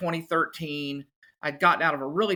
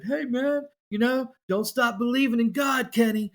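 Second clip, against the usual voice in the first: teasing friends; 7 semitones higher than usual. Retold or imitated. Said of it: "they had lots of jokes with it".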